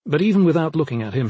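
usual speaking voice

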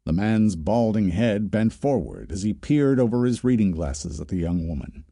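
plain reading voice

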